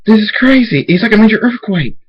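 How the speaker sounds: high, loud, panicky exclamation; alarmed shouting. Acted. Applejack reacting to the earth splitting, alarmed shout. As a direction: shout in alarm over the chaos.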